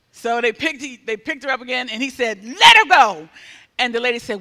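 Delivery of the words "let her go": loud, insistent order; firm command. Retold or imitated. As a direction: belt out the order. Healer repeats order.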